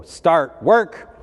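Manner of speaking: stern schoolteacher's shout; barked teacher command. Retold or imitated. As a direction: bark it like a strict art teacher. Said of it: "bang a buzzer and go".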